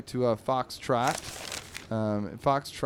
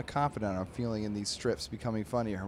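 usual speaking voice

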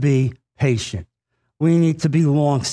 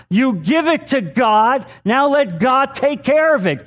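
rising preaching; 5 semitones higher than usual. Acted. Preacher urging the church to rely on God, building fervor. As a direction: build the sermon to a fervent peak.

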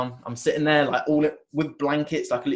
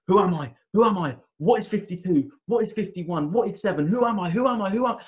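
reliving the terror, calling out higher; panicked screaming; 7 semitones higher than usual. Retold or imitated. Retelling his ayahuasca panic, high frantic cries. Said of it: "laying there screaming".

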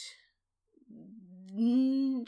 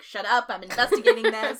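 louder, mock-irritated brush-off; mock snappy retort; 3 semitones higher, 9 dB louder than usual. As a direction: bat the critics away like a cranky prosecutor. Starr voiced brushing off critics.

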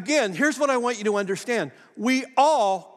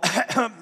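small demonstrated throat-clearing cough; little cough; 3 semitones higher than usual. Shown as a demonstration. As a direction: give one brief imitation cough. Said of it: "I was just a little".

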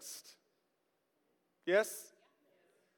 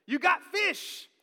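high, hammed-up casual shout; loud mock-delighted greeting; Peter; 9 semitones higher, 4 dB louder, much slower than usual. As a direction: call out with exaggerated enthusiasm, stretching the words. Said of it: "here is the way I viewed it".

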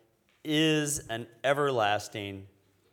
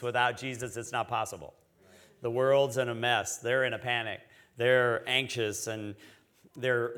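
normal talking voice

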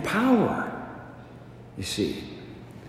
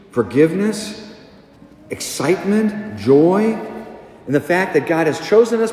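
fervent preaching; 3 semitones higher than usual. Acted. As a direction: preach each word as a proclamation. Preaching a list at full force.

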